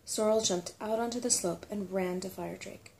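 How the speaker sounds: plain reading voice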